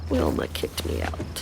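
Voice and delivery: hushed, miserable admission; soft, voiceless confession; distressed whisper. Acted. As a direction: whisper the bad news, defeated. Distressed, admits being evicted.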